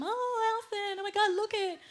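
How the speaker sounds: put-on high, soft girly gush; gushing girlfriend. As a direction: swoon over a romance film. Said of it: "they're like".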